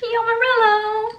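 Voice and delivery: high, sung-out catchword cry; gleeful exclamation. Real, not acted. Vlogger delighted with her pistachio coffee, high gleeful call.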